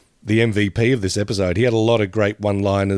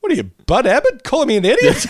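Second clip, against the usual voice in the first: high, loud mock-indignant comeback, laughing at the end; indignant retort; 4 dB louder than usual. A pal ribbing [name], he snaps back with mock indignation. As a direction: [name] firing back at his friend, hammed-up outrage.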